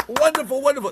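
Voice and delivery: high, loud gushing praise; gushing mother. Retold or imitated. Mother at t-ball cheering her son, high and loud.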